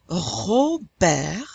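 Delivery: drawn-out French "Robert", T silent; French wet and dry R. Shown as a demonstration. Teacher models both Rs, slow French "Robert". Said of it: "it starts off with a wet R".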